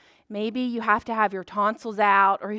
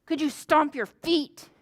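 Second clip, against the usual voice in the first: usual speaking voice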